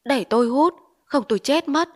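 loud demand. Acted. Addict shouting for his pipe, loud and desperate. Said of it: "nói to".

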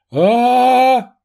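резкий безумный вопль; крикнуть в ярости; отчаянный крик. Acted. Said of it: "раздался позади крик". (безумный крик)

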